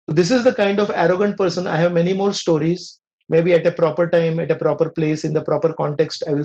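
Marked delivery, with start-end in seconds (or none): none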